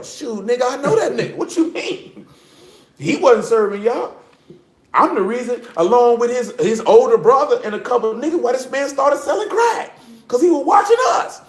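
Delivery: high, laughing, rising to a shout; laughing retort. Retold or imitated. Answering the dude who doubts his friend, laughing and shouting.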